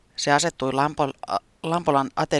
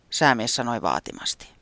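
ordinary delivery

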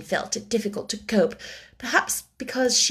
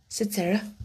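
ordinary delivery, nothing marked

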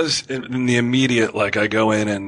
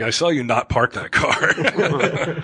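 slow laughing tease; 5 semitones higher, slower than usual. Pretend.

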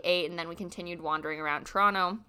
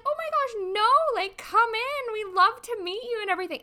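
high, sweet, gushing mom voice, much higher; imitating his mom; 13 semitones higher than usual. His mom eagerly welcoming her into the room, in a high gushing voice. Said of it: "And she's like".

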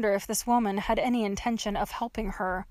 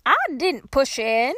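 put-on slow, louder folksy old-lady retort; defensive old woman; 4 semitones higher, 5 dB louder than usual. A narrator's reading. Old woman denies blame to trapped girl, slow and defensive. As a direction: push back like a batty old woman.

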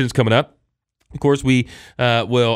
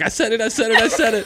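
put-on excited kid; 10 semitones higher than usual. Kid running back triumphant.

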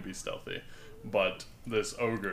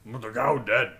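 ogre voice; 7 dB louder than usual. Acted.

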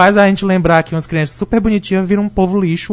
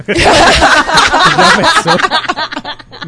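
laughing; 12 semitones higher, 3 dB louder, much slower than usual. Real, not acted. Rindo da ideia de filhos.

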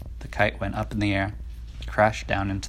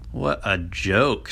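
1 mocking robins; slower than usual. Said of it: "said the robins".

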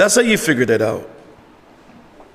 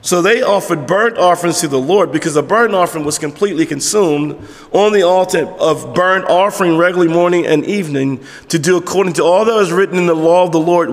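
ordinary talk

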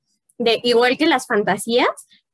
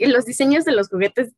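ordinary talk, nothing marked

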